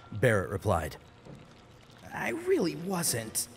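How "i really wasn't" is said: sheepish denial. Acted. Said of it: "Jesse objected".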